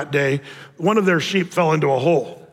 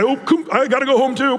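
sheepish excuse; 6 semitones higher than usual. Retold or imitated. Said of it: "the next guy".

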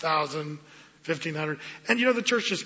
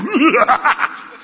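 laughter; 8 semitones higher, 8 dB louder than usual. Real, not acted. Laughs at own impression.

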